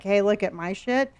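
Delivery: ordinary talk, nothing marked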